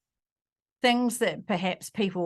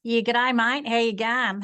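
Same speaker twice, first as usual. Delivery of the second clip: cheeky broad Australian g'day; playful Australian banter, broad vowels; broad Aussie greeting; 3 semitones higher, 3 dB louder than usual. Acted. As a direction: stir her with a thick Australian accent. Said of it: "She likes to stir because I'm in Australia".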